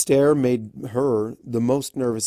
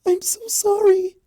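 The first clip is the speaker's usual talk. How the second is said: very high, louder, cracking; tearful apology; 6 dB louder than usual. A narrator's reading. Fluttershy apologising to Discord, tearful. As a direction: sob out the apology. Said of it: "her face streaked with tears".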